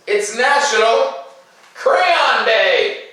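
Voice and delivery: high, loud, laughing announcement; teacher thrilling her pre-K class with the best day; excited big reveal. Acted. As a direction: burst out with it like the best news ever. Big reveal saved for last.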